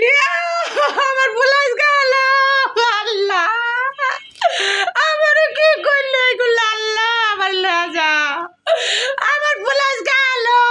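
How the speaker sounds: drawn-out, high, mock wailing cries; hammed-up lament to pull the son in; fake wailing. Mother-in-law wails so her son comes running, hammed-up. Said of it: "কাঁদতেছো কে?".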